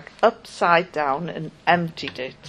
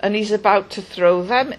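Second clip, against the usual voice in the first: ordinary delivery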